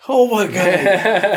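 slow, high oh my God through a laugh; drawn-out, laughing oh my God; laughing disbelief. Retold or imitated. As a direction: laugh it out at the luck. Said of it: "I'm all like".

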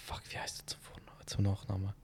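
under-breath whisper; whispering to himself. Real, not acted. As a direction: whisper it to yourself while thinking. Searching for a name, to himself.

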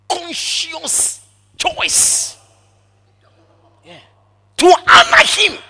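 loud, high burst on honor; shouted emphasis. Real, not acted. Preacher shouting to the congregation, loud and high. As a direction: proclaim it at full force.

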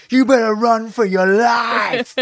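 sudden high, slow shouted warning; creepy grandpa. Retold or imitated. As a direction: yell it like a mad old man scaring a kid. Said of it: "He goes".

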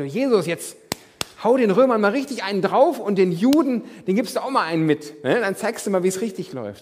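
loud, high, pushy demand; demanding crowd. Retold or imitated. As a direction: hammer it out like impatient followers wanting a fight. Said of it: "viele wollten von Jesus so nach dem Motto". (laut und fordernd, als ungeduldige Anhänger)

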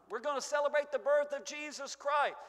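ordinary talk, nothing marked

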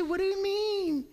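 high whiny question; congregation whine demo. Shown as a demonstration. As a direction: show them the whine to repeat.

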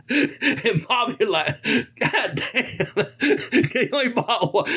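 real laughter through the words, pitched high; laughing. Real, not acted. Teller at the punchline of the candy bar story, laughing. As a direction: barely get it out for laughing, high voice.

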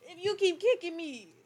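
indignant complaint. Real, not acted. Complaining friend keeps kicking her.

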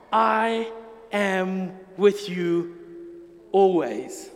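very slow I am with you, always; slow, tender close, always dropping low; slow, lingering promise. Shown as a demonstration. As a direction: stretch each word and let always drop low. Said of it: "But no matter where you are".

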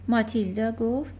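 ordinary delivery

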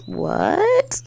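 very high, rising squeal of disbelief; acted shock. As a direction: react stunned, voice shooting up. Reaction to being punched.

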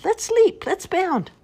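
excited bunny. Acted. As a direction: bounce the words like a hopping bunny. Bunny voiced in children's story.

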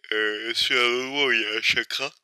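talking through a yawn; words stretched by a yawn. Real, not acted. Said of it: "je baille". (en bâillant)

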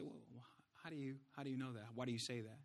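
very soft, low questioning aside; doubting listener. Acted. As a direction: murmur it like a sceptic in the audience.

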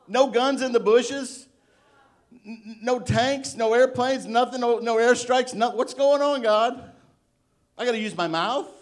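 mock outraged disbelief. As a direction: play up the shock that there are no weapons. Preacher mimics stunned Israelites.

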